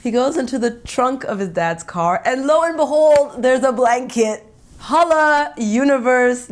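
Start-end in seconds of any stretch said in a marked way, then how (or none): 4.8-6.5 higher and louder, a happy shout-out; thrilled call to the universe; excited cheer. Real, not acted. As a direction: celebrate like the stars aligned. Celebrating the lucky blanket.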